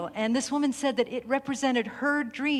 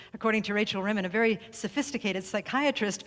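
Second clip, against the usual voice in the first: usual speaking voice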